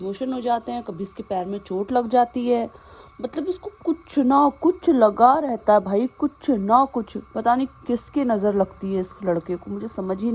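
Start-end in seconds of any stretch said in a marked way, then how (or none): 3.2-7.2 slow, loud stress on कुछ ना कुछ; exasperated emphasis. Real, not acted. Fed-up owner complaining the dog is always ill, slow stressed. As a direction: lean hard on कुछ ना कुछ, slowly. Venting about the dog's illnesses.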